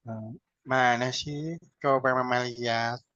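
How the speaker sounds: slow, soft, disengaged parent; half-listening mum brushing off the child's excitement; flat uninterested reply. Shown as a demonstration. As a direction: answer the child without any interest. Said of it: "non-verbal tidak nyambung".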